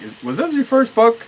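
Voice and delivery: high, teasing retold question; incredulous question. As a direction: imitate a baffled critic, voice shooting up. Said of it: "The first one was like".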